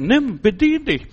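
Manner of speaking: open-handed, slow invitation from a loving father; inviting father. Acted. Father voiced inviting son.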